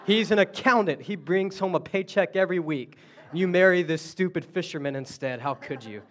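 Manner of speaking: loud, high, laughing mock scold; scolding mother-in-law. Mother-in-law scolding her daughter over the fisherman, mock scolding. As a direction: nag him like a disappointed mother-in-law. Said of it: "She's gonna say".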